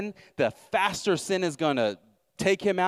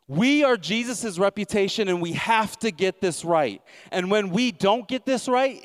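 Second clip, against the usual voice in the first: higher, louder, slowed, pressing each line; full-force preaching; 6 semitones higher, 4 dB louder than usual. Acted. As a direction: pastor urging the church to get it right, fervent preaching. Preaching climax.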